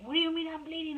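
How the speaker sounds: reliving the panic of finding blood; retold hysterical panic. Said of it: "I was all hysterical".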